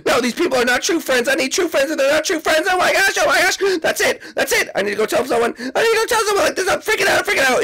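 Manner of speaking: high, frantic, near tears; upset Iggy. Acted. Iggy panicking after his outburst, frantic and tearful. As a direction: panic and whine that nobody is a true friend.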